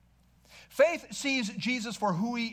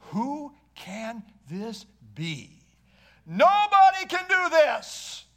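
awed exclamation; 3 semitones higher, 5 dB louder, slower than usual. Acted. Disciples marvelling at Jesus.

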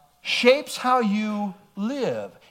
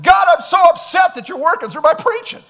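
a sudden higher, louder outburst at God; mock upset shout; 7 semitones higher, 9 dB louder than usual. Preacher mimicking himself complaining to God, in a mock shout. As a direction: throw the complaint at God, half-joking. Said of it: "Be like".

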